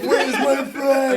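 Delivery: high, loud, very slow laughing mock panic; imitating a frantic friend. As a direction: ham up your friend's desperate demand. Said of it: "just like".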